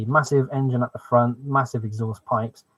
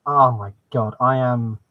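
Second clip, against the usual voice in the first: ordinary talk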